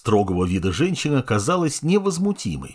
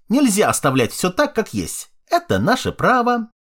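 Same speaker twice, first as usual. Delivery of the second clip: excitable jester; 8 semitones higher than usual. Acted. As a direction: rattle it off like a twitchy fool. Jester argues shrilly.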